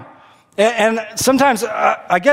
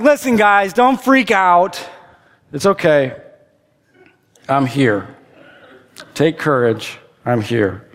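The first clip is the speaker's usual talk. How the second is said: reassuring; 4 semitones lower, slower than usual. Retold or imitated. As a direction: settle their panic gently. Jesus calming frightened disciples.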